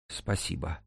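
very soft, hushed thanks; шёпот. A narrator's reading. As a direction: say it barely above a whisper. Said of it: "прошептала Акулина". (тихо, почти шёпотом)

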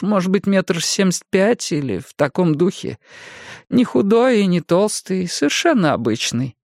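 высокий голос пожилой женщины; мягкая разговорчивая старушка. A narrator's reading. Jenny describes man. (голос старушки)

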